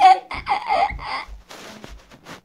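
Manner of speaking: squealing high cackling laugh; a silly shrieking laugh; high cackle. Retold or imitated. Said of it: "She's like, just to be funny".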